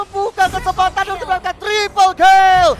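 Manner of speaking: high, fast, rising hype commentary; fighting game announcer. Acted. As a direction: call the teamfight at full hype. Rising live play-by-play on teamfight.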